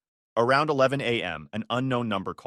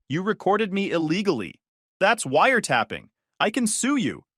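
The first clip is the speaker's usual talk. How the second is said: higher-pitched indignant accusation; 7 semitones higher, 3 dB louder than usual. A narrator's reading. Exposed lover threatening to sue the husband, indignant. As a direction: accuse with mild outrage.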